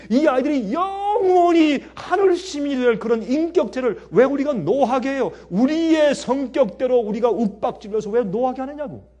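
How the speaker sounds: tearful plea. Real, not acted. Impassioned plea, cry heard.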